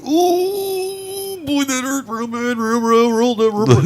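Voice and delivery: high, hurting whine, voice breaking; pained whimpering. Retold or imitated. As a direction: whimper like the pain is unbearable. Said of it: "I'm like".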